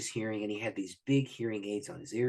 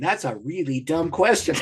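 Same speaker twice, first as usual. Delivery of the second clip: laughing; 5 semitones higher, 7 dB louder than usual. Real, not acted. Laughing at Jesus's obvious question.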